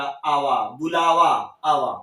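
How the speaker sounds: ordinary delivery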